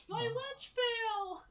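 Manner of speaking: high, slow, pathetic wail; whiny kid. Pretend. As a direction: whine it like a crushed little kid. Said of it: "the most pathetic, whiny kid thing ever".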